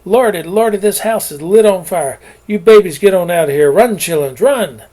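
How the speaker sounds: loud, high alarmed calling; the helper hollers at the children to run; hollering in alarm. A narrator's reading. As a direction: shout to get the children out. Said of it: "she started hollering".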